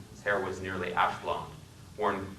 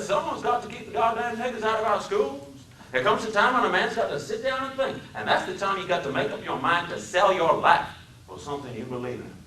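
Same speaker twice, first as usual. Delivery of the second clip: put-on preachy Southern accent; 12 semitones higher than usual. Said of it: "His speech was the deepest South".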